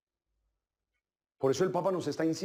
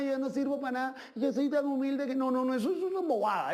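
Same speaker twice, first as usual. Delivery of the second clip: mock falsely humble; 5 semitones higher than usual. Mocking falsely humble person.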